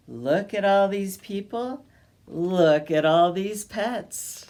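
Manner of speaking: ordinary delivery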